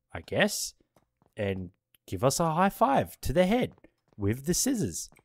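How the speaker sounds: ordinary delivery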